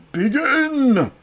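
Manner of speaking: high, loud starting shout; loud call. Acted. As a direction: bellow it to start the race. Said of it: "With a loud".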